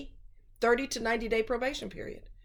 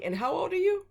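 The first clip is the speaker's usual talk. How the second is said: incredulous; 10 semitones higher than usual. Real, not acted. Scolding an older man who only remembered her body, incredulous. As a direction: pitch jumps way up in disbelief.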